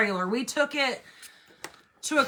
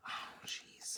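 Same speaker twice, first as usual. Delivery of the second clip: whispering; 19 dB quieter than usual. Real, not acted. Muttering while fixing marker.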